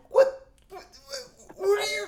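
very high, loud stammer of disbelief; hammed-up speechless bafflement; acted disbelief. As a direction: sputter as if dumbfounded, voice shooting up. Acts out his stunned reaction.

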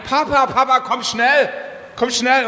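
a child's hurried call for help, mildly imitated; urgent call. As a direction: call out Papa quickly, like a kid in a hurry. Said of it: "ruft's ganz eilig".

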